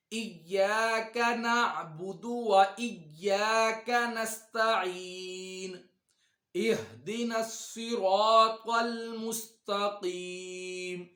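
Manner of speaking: slow, melodic tajweed recitation, drawn out; slow Quranic recitation. Shown as a demonstration. Teacher reciting slowly for students to follow. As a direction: recite each word slowly for students to repeat.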